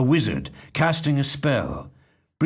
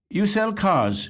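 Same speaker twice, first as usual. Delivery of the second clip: put-on a higher, slow, curious question from a young woman; lightly voiced young woman; 9 semitones higher, slower than usual. A narrator's reading. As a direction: ask gently as the young woman, a touch higher. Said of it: "she inquires".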